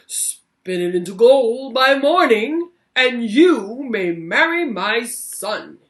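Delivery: king giving a grand ultimatum; commanding king. Acted. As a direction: order her like a king who expects results. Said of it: "he said".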